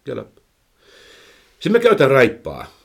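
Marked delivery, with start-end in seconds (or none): 0.0-0.3 short, restrained command; gentle one-word cue to a horse; light cue volume. Shown as a demonstration. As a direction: give the cue lightly, without raising the voice. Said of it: "tällä äänen voimakkuudella".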